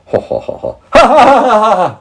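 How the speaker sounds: high, loud hearty laugh; Percy laughing out loud. A narrator's reading. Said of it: "he laughed out loud".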